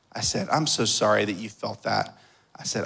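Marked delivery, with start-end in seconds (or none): 0.5-2.2 soft, low fatherly apology; quietly and tenderly say sorry; gentle apology. Retold or imitated. Father to the son who feared his anger, gently apologetic.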